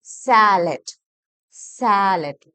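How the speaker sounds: സാലഡ് as "SAA-let", first syllable punched and stretched; long first vowel. Shown as a demonstration. Teacher models the wanted sound, long first vowel. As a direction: draw out the opening of സാലഡ്. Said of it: "ദീർഘമാണ് വേണ്ടത്".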